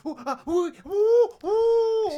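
high, rising ooh squeals; agonised oohs. Real, not acted. Overwhelmed co-host squealing over choices. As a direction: squeal like on hot coals. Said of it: "Get off those hot coals".

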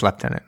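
lieutenant said with an F, "lef-TEN-ant"; British pronunciation. Shown as a demonstration. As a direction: say lieutenant British-style as "leftenant". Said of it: "If you're from the U K".

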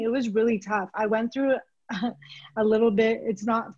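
ordinary delivery

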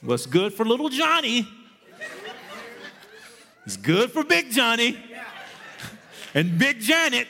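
preaching. Acted. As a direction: exhort the church with a laugh. Preaching at full force.

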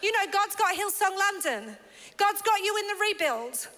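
rally the church with passion; preaching. Acted. Preaching at full force.

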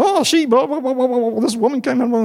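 high rushed complaining gabble; indignant teenage girls. Retold or imitated. Youth group girls complain to the leader, gabbling indignantly. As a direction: gabble out the grievance like upset teens. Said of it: "they go".

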